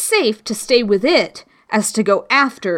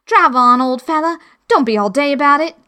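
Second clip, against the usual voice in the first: louder, fast, impatient nudge; brisk Griffin; 6 semitones higher, 5 dB louder, faster than usual. Acted. Griffin urging the Mock Turtle to get on with it, loud and quick. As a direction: hurry the old fellow along.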